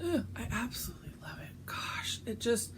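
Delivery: breathless whisper of delight; hushed adoring whisper; whispered gushing. Real, not acted. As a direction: whisper it like you're in love with it. Gushing over her stitching.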